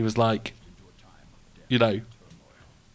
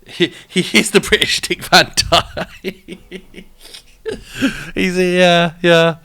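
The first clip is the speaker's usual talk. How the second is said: slow, higher, laughing through the words; real laughing; 6 semitones higher, slower than usual. Real, not acted. Streamer joking with viewers about a DJ, laughing as they talk. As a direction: let a real laugh break up the line.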